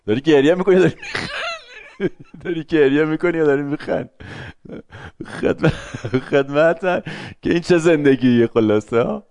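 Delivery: laughing through the question; words broken by real laughter. Real, not acted. Lecturer teasing a listener while laughing.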